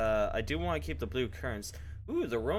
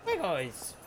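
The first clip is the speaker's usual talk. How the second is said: higher cheery call to the dogs; higher cheerful hello; excited greeting; 6 semitones higher than usual. Real, not acted.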